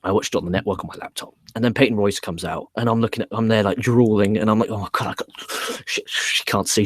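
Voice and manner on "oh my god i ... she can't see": acted, breathy half-whisper to himself; hushed inner panic. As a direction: whisper it like a secret panicked thought. Acted panicked inner thought while drooling.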